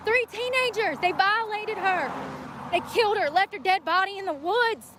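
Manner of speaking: pitched high in anguished outrage; outraged. Real, not acted. Ranting to her viewers about her friend's killers, high anguished outrage. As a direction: spit it out in disbelief and fury.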